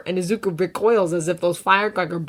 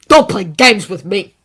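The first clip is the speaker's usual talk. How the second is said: louder, gritted-teeth warning; 2 semitones higher, 12 dB louder than usual. A narrator's reading. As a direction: force it through gritted teeth. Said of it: "Bakugo grits out".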